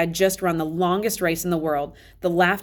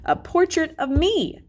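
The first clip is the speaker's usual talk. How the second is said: mock-proud reveal; 10 semitones higher than usual. A narrator's reading. Tushman jokes about pumpkin portrait.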